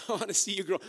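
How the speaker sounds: chuckling through the words; playful laughing coo. Acted. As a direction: laugh it out warmly to the plants. Preacher acting to plants, laughing.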